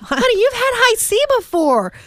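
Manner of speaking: high voice, half laughing at her son; exasperated mom. Retold or imitated. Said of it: "I'm like".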